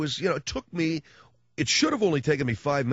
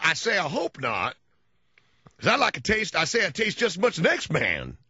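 Southern cartoon rooster blowhard boasting; Foghorn Leghorn; 6 semitones higher than usual. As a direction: ham up the old Southern rooster. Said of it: "he talked like Foghorn Leghorn".

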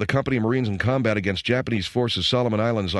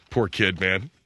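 ordinary delivery

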